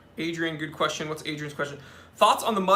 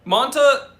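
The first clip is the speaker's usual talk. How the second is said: loud high call of a name; 8 semitones higher, 9 dB louder than usual. Real, not acted. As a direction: shout the name across the room. Calling out to someone.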